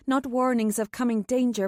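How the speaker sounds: usual speaking voice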